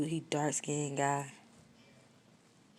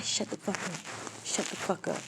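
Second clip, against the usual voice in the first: menacing order; 2 semitones higher, 5 dB quieter than usual. Retold or imitated. Attacker silencing her.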